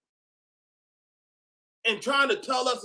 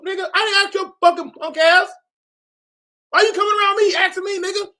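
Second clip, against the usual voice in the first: high, loud, outraged yell; high, loud, crying-edged rant; furious retort; 11 semitones higher, 5 dB louder than usual. Acted. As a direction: yell back at a nosy guy. Angry retort to nosy man.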